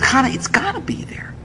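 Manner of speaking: hushed, urgent reply; whisper. Retold or imitated. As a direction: whisper back to your wife.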